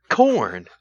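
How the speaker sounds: imitating a comedian's bewildered rediscovery; high-pitched mock amazement, as if tasting it new. Host retells the Alzheimer's candy corn bit, in high mock wonder.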